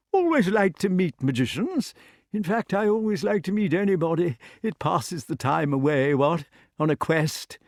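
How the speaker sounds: high, chatty eccentric knight; affable dotty king. Acted. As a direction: ramble on sociably in a high king's voice. Voices chatty King Pellinore.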